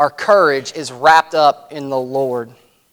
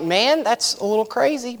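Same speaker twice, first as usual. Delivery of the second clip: mock disbelief; 6 semitones higher, 5 dB quieter than usual. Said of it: "And I'm like".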